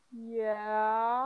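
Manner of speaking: mock-reluctant owning up, stretched out; sheepish admission. Kid answering mom about brother, sheepish drawn-out admission.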